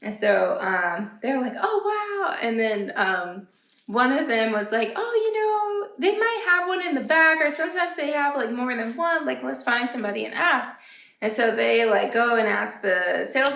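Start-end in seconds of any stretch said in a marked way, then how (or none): 1.6-2.4 impressed shopper. Retold or imitated. 5.0-10.7 helpful shopper. Retold or imitated. Shopper suggests asking staff.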